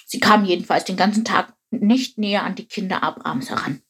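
plain reading voice

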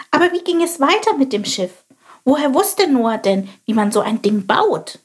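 high, curious little bird voice; little sparrow voice. Acted. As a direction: chirp it like a small inquisitive sparrow. Chilp asks further. (hohe, neugierige Spatzenstimme)

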